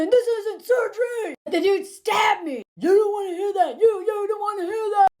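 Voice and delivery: high, hammed-up tirade; imitated yelling. Stabbed man telling her his past, mock yelling. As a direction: mimic him ranting at full stretch, drawing out the repeats. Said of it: "he's yelling all of this".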